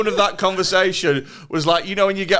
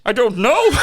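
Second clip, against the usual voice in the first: high, loud Irish lilt; Irish impression; 6 semitones higher, 5 dB louder than usual. Answering why he's Irish, still in Irish impression. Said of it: "Why is he Irish?".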